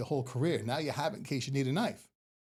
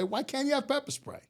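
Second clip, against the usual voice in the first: mock indignant; 10 semitones higher than usual. Real, not acted. Mock indignant rhetorical question.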